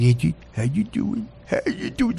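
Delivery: lightly put-on doting voice; soft mock dog-talk; imitated gentle Dan. Host imitating Dan greeting the dog, lightly put-on coo. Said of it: "but Dan's like".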